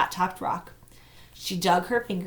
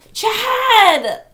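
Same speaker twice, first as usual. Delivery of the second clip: loud shout, much higher; calling out; 12 dB louder than usual. A narrator's reading. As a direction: shout the name across the gully. Searching woods, calling his name.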